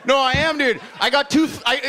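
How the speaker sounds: usual speaking voice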